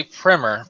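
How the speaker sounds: "primer" said as "primmer"; short i in "primer", rhyming with swimmer; short-i pronunciation. Shown as a demonstration. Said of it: "I say primer personally".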